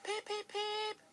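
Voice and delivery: high, loud peeping call. Acted. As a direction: call out to them. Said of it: "he called".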